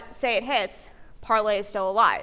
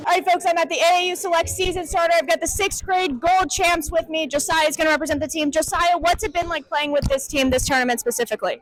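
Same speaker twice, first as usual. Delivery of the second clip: higher, louder, fast sideline host voice; loud, high-energy on-site interview intro; hyped courtside reporter; 5 semitones higher, 6 dB louder than usual. Acted. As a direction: pump up the crowd like a live event host.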